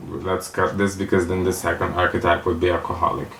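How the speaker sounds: usual speaking voice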